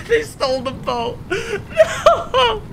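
high, wailing half-laughing sob plea; fake crying. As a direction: beg like a crybaby who lost everything. Boat stolen, fake crying plea.

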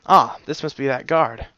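ordinary delivery, nothing marked

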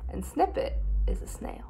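hushed secret whisper. Acted. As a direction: lean in and whisper it. Whispered aside to listeners.

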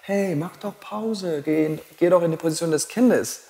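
sweet tempting voice. Retold or imitated. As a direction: lure them into a break, sugary. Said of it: "wenn jemand aber zusätzlich zu deinem Kopf sagt". (süßlich lockende Stimme, leise und langsam)

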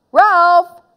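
high, loud call of "Ralph". Acted. Boy calling his vanished friend, loud high call. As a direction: call the name sharply across the room.

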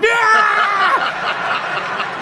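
high, drawn-out snarling scream; dog's attack yell. Acted. Dog lunges at a passer-by, long high attack yell.

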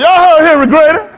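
loud, high laughing shout; laughing taunt. Retold or imitated. Kid taunting the neighbors after dad's defense, loud laughing shout. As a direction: crow it out at them, laughing.

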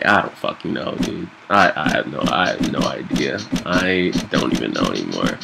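defeated. Real, not acted. Giving up after fight.

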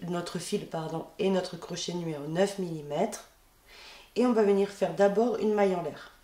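ordinary talk, nothing marked

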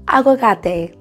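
usual speaking voice

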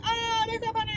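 high, airy fake delight; mock gushing. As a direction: gush like a viewer who won't share. Mocking viewers who just watch.

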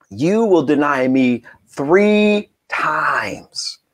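slow, weighty, ending in a whisper; solemn prophecy. Acted. Jesus warning Peter of his denial, slow and solemn. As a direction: draw it out, let the last line fade.

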